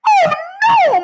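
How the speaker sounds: loud motherly cry of dismay; distraught cry. A narrator's reading. Mother learning of the lost teeth, distraught cry. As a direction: cry out in shock. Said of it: "my mother cried".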